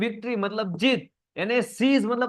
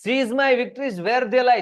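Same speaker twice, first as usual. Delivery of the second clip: slow poem recitation; 6 semitones higher, 4 dB louder, much slower than usual. Shown as a demonstration. Teacher recites poem line again.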